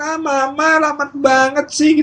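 high, loud, drawn-out complaint; mock an impatient kid whining at mum; whining child. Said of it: "komunikasinya jadi nggak nyaman". (anak merengek kesal, nada tinggi)

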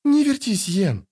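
high-pitched mild grumble; understated high fussing; grumbling. A narrator's reading. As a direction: scold a fidgeting friend. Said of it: "проворчала Трис".

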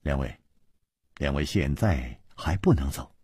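low, soft and serious; suddenly grave. A narrator's reading. Chu Liuxiang gravely tells the two brothers to stay. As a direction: hold them back with quiet gravity. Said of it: "神色忽然凝重起来".

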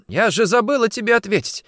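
high, loud joyful acceptance; elated. Acted. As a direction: burst out happily, gushing. Face brightens, accepts the proposal.

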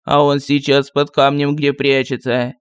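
put-on low, unhurried grumbling old sorcerer; lightly voiced village sorcerer; gruff sorcerer voice. A narrator's reading. Sorcerer voice held. (ворчливый голос колдуна)

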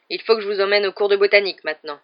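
loud, firm teacher command; stern order. A narrator's reading. Snape marches pupils to herbology, loud and stern. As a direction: keep barking the instruction.

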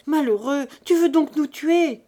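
high, loud cry of alarm; panicked mother's reproach; terrified stammering plea. A narrator's reading. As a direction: cry out to stop him, terrified. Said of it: "en bégayant".

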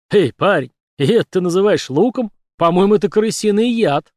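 loud jeering taunt; mocking. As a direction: jeer at him, laughing at him. Fat merchant mocks Doyle.

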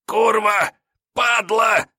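high, loud desperate wail; hopeless howl. A narrator's reading. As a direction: howl it out in despair. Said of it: "он взвыл от безнадежности". (вопль отчаяния)

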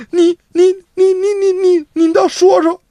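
a sister too shaken to get words out; stammering sister. Acted. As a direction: choke on each 你 before asking.

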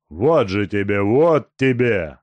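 loud, slow vengeful shouting; angry blows. Acted. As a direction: yell with each lash. Тумба бьёт Сеньку. (злые крики при ударах)

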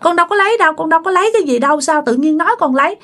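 put-on high, fast pleading child denial; crying denial. Beaten child pleading with uncle that he took nothing. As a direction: plead in a high rush of panic. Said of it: "bạn khóc, bạn nói".

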